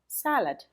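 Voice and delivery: "salad" stressed on the first syllable, SAL-ud. Shown as a demonstration. Teacher giving learners the example word, first-syllable stress on "salad". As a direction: hit the first syllable of "salad", second one weak. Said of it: "not stressing the right syllable. For example".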